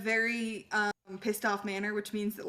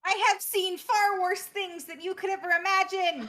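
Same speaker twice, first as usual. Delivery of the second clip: proud warhorse; 8 semitones higher than usual. Acted. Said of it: "Galba answers Garlic in character".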